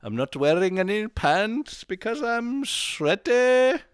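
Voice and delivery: high, slow, grandiose preacher greeting; hammed-up show welcome. Host welcomes listeners to his show like a mock preacher. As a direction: greet the flock like a mock televangelist.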